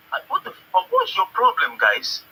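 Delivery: high, loud outburst; outraged. Real, not acted. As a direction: blow up at them in disbelief. Caller erupts in outrage.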